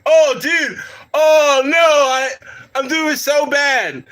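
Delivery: high, loud oh no; dismayed exclamation. Real, not acted. Laughing at himself.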